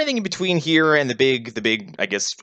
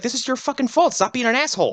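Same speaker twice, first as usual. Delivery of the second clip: mock bratty teen; 11 semitones higher than usual. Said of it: "he's like".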